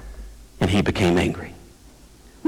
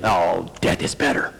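mock despondency; 7 semitones lower than usual. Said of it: "and leaving there saying".

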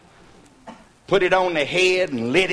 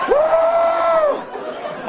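wild whoop. Retold or imitated. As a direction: whoop as everything goes wild. Lights go out in the fight.

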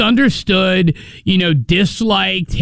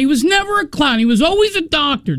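insistent; 8 semitones higher than usual. Real, not acted. Heated argument over Patch Adams.

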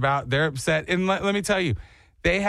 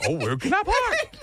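guest asking; 14 semitones higher than usual. Retold or imitated. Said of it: "You go".

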